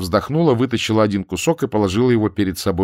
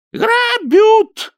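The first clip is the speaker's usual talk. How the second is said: high, loud yelp for help; crying out; 6 dB louder than usual. A narrator's reading. Ninka cries out that she's being robbed. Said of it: "вскрикнула Нинка".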